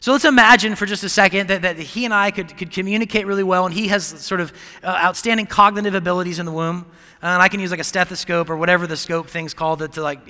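ordinary talk, nothing marked

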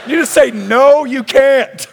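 high, loud comic outburst; hammed-up incredulous yell; exasperated protest. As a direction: insist on it in mock desperation. Imagined protest to Jesus.

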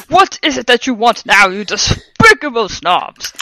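loud, indignant headmaster; angry. A narrator's reading. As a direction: demand it in theatrical fury. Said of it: "he asked angrily".

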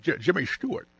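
stammering old movie-star impression; Jimmy Stewart. Co-host showing off an actor impression, stammering star voice. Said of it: "that's a good impression".

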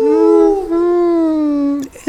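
long, high, loud howl; wolf-like howl. Acted. Character in the story, howling out loud. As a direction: let out one long sustained howl.

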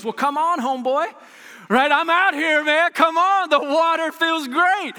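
high, loud and breezy, like calling a buddy over; laid-back homeboy Jesus, upbeat and loud; playful casual Jesus. Retold or imitated. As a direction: call him out onto the water with easy swagger. Jesus voiced calling Peter, casual.

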